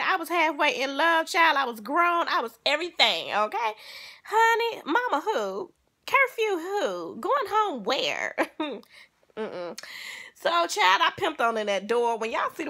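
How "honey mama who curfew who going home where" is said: sassy. Real, not acted. Defiant rhetorical questions, high.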